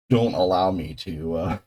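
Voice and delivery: ordinary delivery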